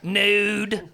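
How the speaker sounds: slow, level creature speech; simple creature naming things word by word; drawn-out broken English in a put-on voice; Belle's voice.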